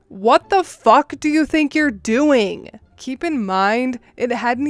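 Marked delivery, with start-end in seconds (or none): 0.1-2.3 raised, higher-pitched outraged demand; narrated scream. A narrator's reading. Sibling confronting relatives looting the house, restrained angry shout. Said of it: "screamed at them".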